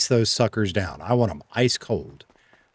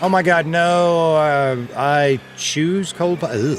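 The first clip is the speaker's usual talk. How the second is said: mock despair; 6 semitones higher, 3 dB louder, much slower than usual. Mock agony over a chat choice.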